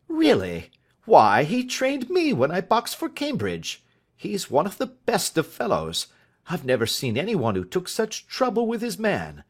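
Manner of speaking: a hint of a flustered genial master; schoolmaster. A narrator's reading. Master enthusing about his trainer.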